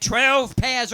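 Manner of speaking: high and loud; proud loud answer. Retold or imitated. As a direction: announce it proudly. English woman proudly answers.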